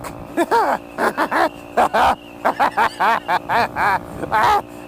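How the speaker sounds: long, high, uncontrolled laughter; real helpless laugh at own joke; laughing fit. Real, not acted.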